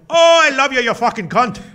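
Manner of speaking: loud, high-pitched faux British shout; terrible British accent. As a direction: yell it in a badly faked British accent. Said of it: "loudly in a, in a terrible faux, you know, British accent".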